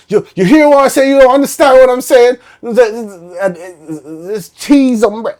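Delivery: high, loud Bajan accent impression; imitated Bajan man. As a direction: play the insistent Bajan customer. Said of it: "I remember him saying".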